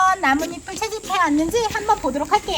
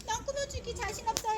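higher, a hint of a timid kid; shy child's voice; 8 semitones higher, 15 dB quieter than usual. Teacher voicing a child who doubts their decorating, higher and timid. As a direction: speak as the kid who is unsure. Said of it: "하는 친구는요".

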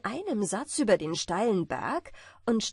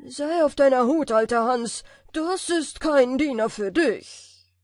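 loud, higher fearful giant; frightened giant; 4 semitones higher, 8 dB louder than usual. A narrator's reading. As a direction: warn yourself in loud fright. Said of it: "fing an, sich zu fürchten". (ängstlicher Riese)

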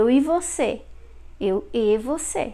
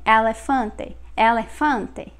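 "elefante" with open eh vowels, Northeast Brazil style; Northeast Brazilian accent. Shown as a demonstration. Teacher showing how northeasterners say it, open-vowel Northeast Brazilian accent. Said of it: "they would pronounce more open".

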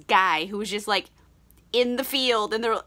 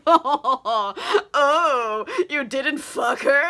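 higher, louder mock-impressed teasing; making fun; 5 semitones higher, 3 dB louder, much slower than usual. Henry mocking Dorian's good deed, teasing. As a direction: tease with drawn-out put-on oohs. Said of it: "just makes fun of him".

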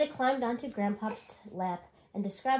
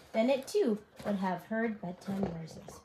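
plain reading voice

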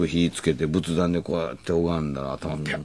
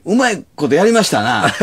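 high, loud cheeky quip; bluffing jab; 11 semitones higher, 7 dB louder than usual. Retold or imitated. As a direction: brazen it out to the boss. Bluffing to the manager.

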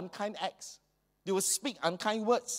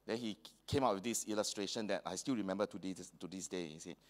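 normal talking voice

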